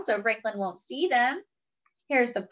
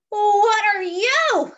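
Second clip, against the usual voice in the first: high, loud, slow frightened cry; 10 semitones higher, 7 dB louder, slower than usual. Acted. The man meets a dragon by the stream, frightened cry. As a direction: cry out in fear at a strange creature. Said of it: "cries the man".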